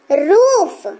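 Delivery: "रूफ" with the oo pressed and long; stressed long oo. Shown as a demonstration. Teacher demonstrating force in "रूफ", long stressed oo. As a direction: lean on the ऊ in "रूफ". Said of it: "जोर डाल रहे हैं".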